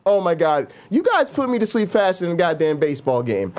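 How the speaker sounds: ordinary delivery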